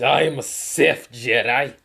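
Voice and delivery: lightly hammed-up dark hero; dramatic declaration. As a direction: proclaim it like a dark lord. Naruto's dramatic self-reveal.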